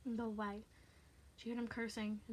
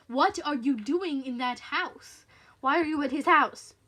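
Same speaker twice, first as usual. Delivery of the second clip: loud, slow, accusing questions; menacing caller demanding answers; angry interrogation; 4 semitones higher, 12 dB louder, slower than usual. Acted. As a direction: lean hard on each word. Irritated caller interrogates her.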